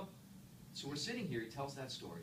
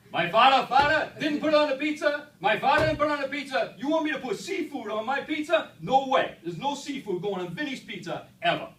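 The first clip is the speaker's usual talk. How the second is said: higher, very loud outraged shouting; Vinny's rant; 13 semitones higher, 16 dB louder than usual. Retold or imitated. Vinny shouting at the speaker about seafood on pizza, furious yell. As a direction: bellow it like an insult to the family. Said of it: "You imagine the rant".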